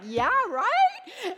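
high, loud sarcastic yeah right. Retold or imitated. Mother scoffing at daughter's great stories remark, sarcastic. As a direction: toss it off with mock disbelief. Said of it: "I'm like".

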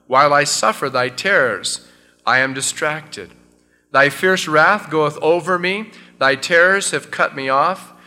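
loud, fervent reading of the psalm; solemn scripture reading. Acted. Pastor reading the psalm aloud to his church, fervent. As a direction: proclaim each verse to the congregation.

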